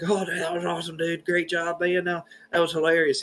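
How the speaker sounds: high, put-on congratulating voice; hammed-up high-pitched praise; mock excited crowd. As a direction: imitate buddies gushing in a high voice. Mimics the crowd congratulating him.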